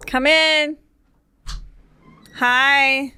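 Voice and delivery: loud, high welcome; calling out. Real, not acted. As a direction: call out cheerfully to arriving guests. Greeting guests arriving at the door.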